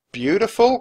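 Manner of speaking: high, loud rising question; incredulous cry. A narrator's reading. Jack doubting Annie about the serpent, incredulous cry. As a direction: throw it back in disbelief. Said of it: "cried Jack".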